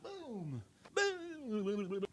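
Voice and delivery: high, drawn-out booming sound effect; dramatic boom. Shown as a demonstration. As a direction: make the crash sound big and dramatic. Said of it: "A little dramatic".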